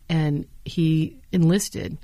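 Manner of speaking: slow, deliberate and he enlisted; slowed for emphasis. Real, not acted. Telling the interviewer about the young pilot, slowly. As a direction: lay each word down slowly. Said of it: "on his eighteenth birthday".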